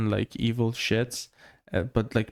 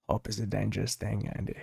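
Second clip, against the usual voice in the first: lower-key, softer movie quote; Morgan Freeman; 3 semitones lower, 6 dB quieter than usual. Acted. Co-host quotes Shawshank to his friend, soft impression. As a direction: say it softly, like a film elder's line. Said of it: "And then Morgan Freeman says".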